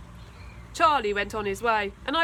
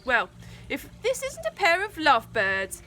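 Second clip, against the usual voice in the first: high mocking bully voice; bully's sneer; 6 semitones higher than usual. A narrator's reading. Bully Charlie teasing the two kids, high sneer. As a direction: sneer it like a big schoolyard bully. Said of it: "he began".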